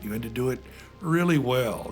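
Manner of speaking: ordinary delivery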